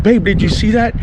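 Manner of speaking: high, breathless amazement; excited call to wife under falling stars. Retold or imitated. Third falling star seen.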